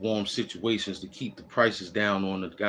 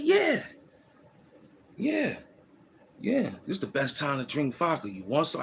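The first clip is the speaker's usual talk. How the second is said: much higher, hammed-up voice for the Ukrainian man; cheerful foreign guy; 5 semitones higher than usual. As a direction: play the carefree guy offering vodka. Voicing Ukrainian man's reply.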